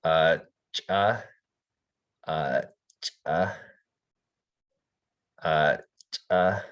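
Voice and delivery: ejective "ch-" with a throat catch; glottal C. Shown as a demonstration. Teacher showing the glottal C, clipped ejective. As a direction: pop "ch-" out from the throat. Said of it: "glottal C would be like".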